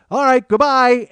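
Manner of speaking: high, loud, drawn-out farewell; loud bluffing goodbye. Retold or imitated. Wife calling her bluff to a haggling kid, loud and high. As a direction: call it out as if really leaving.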